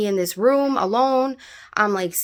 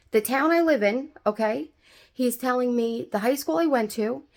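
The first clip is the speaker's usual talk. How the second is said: ordinary talk, nothing marked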